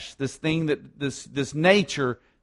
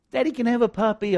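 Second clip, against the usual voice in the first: put-on little girl voice; 7 semitones higher, faster than usual. Girl asks father for puppy.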